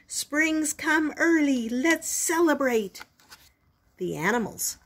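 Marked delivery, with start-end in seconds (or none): 0.1-3.0 high, slow, drawn-out storybook yell; yelled announcement. A narrator's reading. Groundhog yells to all the animals, a stretched-out call. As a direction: proclaim it from the hilltop, stretching the words. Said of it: "opened his mouth and yelled".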